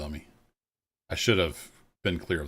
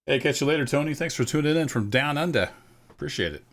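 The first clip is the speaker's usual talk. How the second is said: a clumsy, higher Australian accent, hammed up; cheery badly faked Australian accent, louder; 8 semitones higher, 5 dB louder than usual. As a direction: send off a viewer in a mock Australian accent. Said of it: "Really bad Australian".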